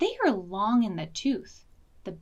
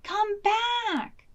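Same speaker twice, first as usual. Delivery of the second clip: higher call after the wolf; shouting call; 11 semitones higher than usual. Acted. Betsy shouting after the runaway wolf, higher. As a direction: call him back across the woods. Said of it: "Betsy shouted".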